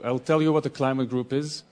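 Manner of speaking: ordinary talk, nothing marked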